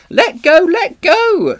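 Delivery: high, loud frantic scream. Acted. Polly screams at the Witch gripping her hair. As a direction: yell it, desperate to get free. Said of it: "screamed Polly".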